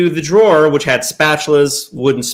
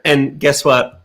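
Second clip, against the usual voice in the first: usual speaking voice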